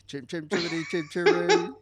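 playful bad-Cockney sweep, breaking into laughter; Bert the Chimney Sweep. Co-host quoting the chimney sweep's song, mock Cockney chant. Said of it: "imagining Bert the Chimney Sweep".

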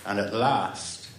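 ordinary delivery

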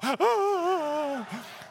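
long high mock sob; hammed-up crying wail. Preacher acting out his teenage crying, high hammed-up wail. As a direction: wail like an exaggerated ugly cry. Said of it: "So I'm crying".

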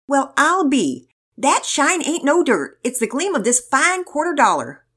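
slow, loud, high Southern drawl. A narrator's reading. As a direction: marvel in a hammed-up Southern accent. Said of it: "Turning from a Southern drawl".

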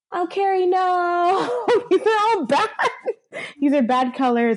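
giggling through a mock scolding; playful dismay through giggles; laughing scold. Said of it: "I was like".

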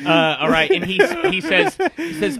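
narrating through laughter; words spilling out between laughs; laughing. Real, not acted. GM cracking up as he starts the scene, laughing.

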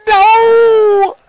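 sudden high, loud cry of dismay; shocked cry. Retold or imitated. Friend at the bar recalls seeing the sunglasses stomped, shocked high yelp. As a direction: yelp it like you just saw the glasses crushed.

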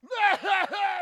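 loud hammed-up hacking cough; fake coughing fit. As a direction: cough in a big comic burst. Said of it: "he can't stop coughing".

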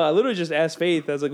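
ordinary delivery

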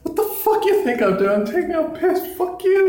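very high, indignant mock rant; mouthing off. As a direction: imitate a drunk friend sassing a cop. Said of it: "He was mouthing off to the cop".

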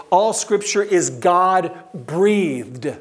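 "All Scripture is God-breathed" drawn out slowly, each word weighted; slow emphatic reading. Shown as a demonstration. Pastor reading the verse to the congregation, slow and weighty. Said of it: "translates that Greek language to say".

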